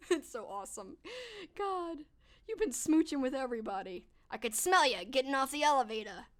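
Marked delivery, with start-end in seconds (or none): none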